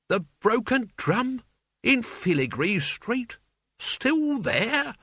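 high amazed questions; pleasantly astonished; surprised. A narrator's reading. Albert amazed.

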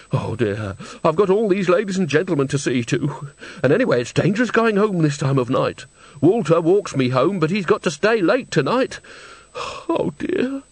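put-on high, plaintive old woman's voice; fretful old woman. A narrator's reading. Old woman frets to Granny about getting home, worried.

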